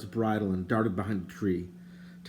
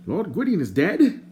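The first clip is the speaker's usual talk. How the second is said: higher, prim and indignant stranger; lightly voiced fussy stranger; 9 semitones higher, 5 dB louder than usual. A narrator's reading. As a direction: play the fussy bard, put out. The bard's voice.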